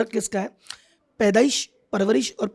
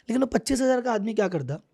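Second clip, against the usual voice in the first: normal talking voice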